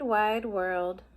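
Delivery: plain reading voice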